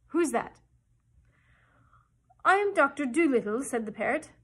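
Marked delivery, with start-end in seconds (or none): 0.1-0.6 louder, higher startled question; startled wide-awake. A narrator's reading. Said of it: "sat up wide awake and said". 2.4-3.6 loud, raised-pitch proclamation; Doctor Dolittle. A narrator's reading. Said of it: "just the way the doctor would have said it".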